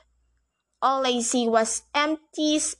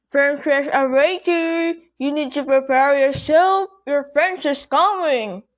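put-on old woman voice; 3 semitones higher, 5 dB louder than usual. A narrator's reading. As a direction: call through the door like an old maid. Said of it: "I heard an old woman voice speaking".

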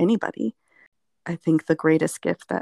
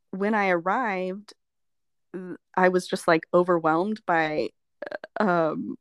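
usual speaking voice

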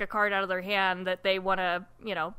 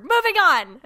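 excited shout; 9 semitones higher, 10 dB louder than usual. Real, not acted. Loud announcement of topic change.

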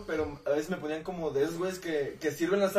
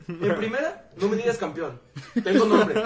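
fired-up retort; 2 semitones higher, 7 dB louder than usual. Retold or imitated. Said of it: "yo sí me emprendí".

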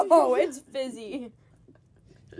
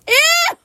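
high shrill squeal of disgust; a squeaky ew as a laugh. Shown as a demonstration. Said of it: "that's how I should laugh".

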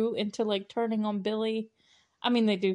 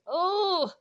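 high, swooping "oooh"; drawn-out "oh"; 10 semitones higher than usual. Retold or imitated. Movie fan reacts to the villain's taunt, swooping high oh. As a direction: let a long oh swoop up high and back.

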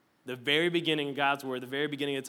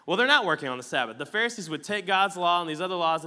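normal talking voice

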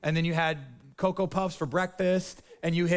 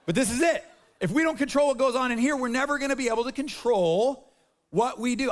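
preach; 5 semitones higher than usual. Acted. Third take, preaching at full force.

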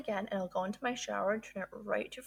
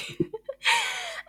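a high, self-conscious giggle at herself; sheepish laugh; 6 dB louder than usual. Real, not acted. Host laughs at her own woo-woo routine, sheepish high giggle. After admitting it sounds woo-woo.

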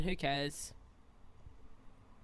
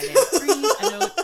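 laughing; 13 semitones higher, 14 dB louder than usual. Real, not acted. Mocking her own plan, laughing.

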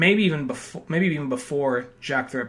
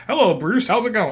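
put-on higher-pitched American accent, light greeting; American-accented Sherlock; 7 semitones higher, 3 dB louder than usual. Sherlock pops in to greet Bruce, misplaced American accent. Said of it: "I don't know why he has that voice".

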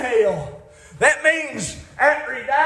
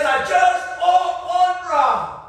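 slow, higher, pleading voice of a man in hell; begging rich man; 7 semitones higher, 4 dB louder, much slower than usual. Pretend. Rich man in hell begging for water, slow pleading. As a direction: drag out the plea like a parched beggar.

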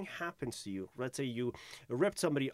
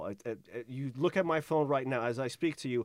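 usual speaking voice

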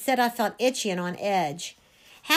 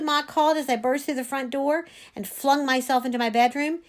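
ordinary delivery, nothing marked

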